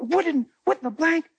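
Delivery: high, shocked woman's voice; startled woman. Retold or imitated. Woman shocked by the pastor's question, high and rising. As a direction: rise up on "what" in alarm.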